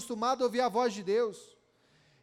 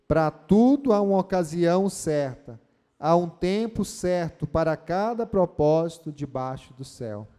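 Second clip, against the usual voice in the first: louder verse recited together; loud group recital; 6 semitones lower, 7 dB louder than usual. Shown as a demonstration. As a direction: lead the church in reciting it. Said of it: "Você pode repetir comigo o verso primeiro".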